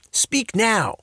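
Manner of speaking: high, forceful command. A narrator's reading. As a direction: order it sharply. Dad demands.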